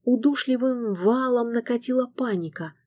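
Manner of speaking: ordinary delivery, nothing marked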